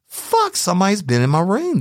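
fuck leaps very high, then high alarm; dawning panic; high, alarmed realization. Retold or imitated. Realizing someone entered room.

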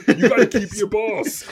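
high, loud, slowed by laughter; laughing. Real, not acted. Comic riffing on the angry bull's life, laughing. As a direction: push the punchline out through real laughs.

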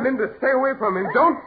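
fast, high, tearful warning cry; a husband frantically warning his wife off; panicked warning. Acted. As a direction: rush the warning out in fear.

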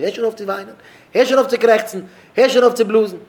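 high, loud mock yelling; acted angry scolding. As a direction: yell each command as an impatient scold. Acted scolding to stop crying. (אויסגעשפּילט בייז שרייען)

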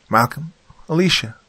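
high-pitched woman calling out; distant calling voice. A narrator's reading. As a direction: call out for missing family. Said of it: "the woman's voice breathed into the cave".

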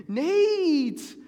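put-on a little brother blaming his older brother, crying out; crybaby wail. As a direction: wail the name like a scared little kid. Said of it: "I'm a little crybaby".

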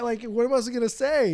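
usual speaking voice

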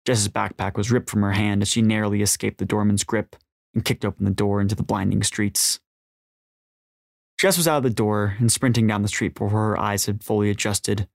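plain reading voice

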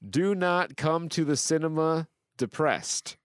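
high, declaimed username; dramatic read-out. Acted. Host reading out a username, dramatic read-out. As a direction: announce it with mock grandeur.

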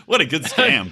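ordinary delivery

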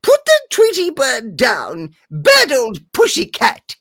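put-on high old woman telling off the cat; Granny voice. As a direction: chide the cat like a fussy grandma. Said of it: "the granny, she was".

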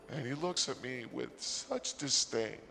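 usual speaking voice

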